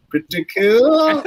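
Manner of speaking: slow, high, playful "Pretty cool"; drawn-out boast. Real, not acted. Playful stretched repeat of the boast.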